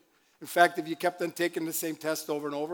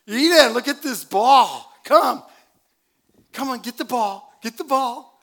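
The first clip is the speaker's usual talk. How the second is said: higher, louder sing-song coaxing, slow at first; coaxing a toddler; 7 semitones higher, 9 dB louder than usual. Acted. Grandfather coaxing his granddaughter toward the ball, higher and louder. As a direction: coax a little girl to come get the ball.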